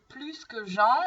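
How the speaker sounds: plus said with its final S sounded, "plyss"; S pronounced in plus. Shown as a demonstration. Teacher's example with a verb, final S of plus pronounced. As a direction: let the S of plus be heard before que. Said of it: "ici, on prononce le S".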